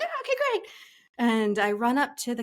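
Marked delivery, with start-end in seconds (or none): 0.0-0.7 excited relief. Retold or imitated. Team's excited reply retold.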